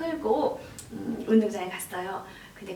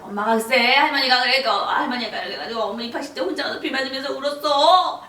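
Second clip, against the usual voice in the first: put-on loud, aggrieved retelling to a child; animated complaint; 2 semitones higher, 7 dB louder than usual. Mother telling seven-year-old daughter her story, loud aggrieved complaint. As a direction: blurt it out like a wronged kid.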